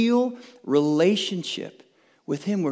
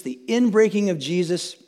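usual speaking voice

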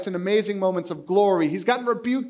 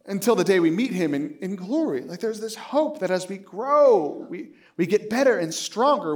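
ordinary talk, nothing marked

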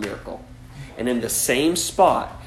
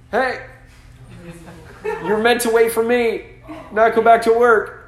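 higher, louder gentle call from the shore; Jesus calling out; 8 semitones higher, 4 dB louder than usual. Acted. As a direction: call them back like a patient teacher.

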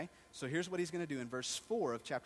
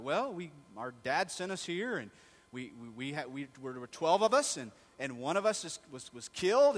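flustered, rising, stumbling excuse; loud panicky stammering; nervous stammer; 8 dB louder than usual. Retold or imitated. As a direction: trip over your words, scared.

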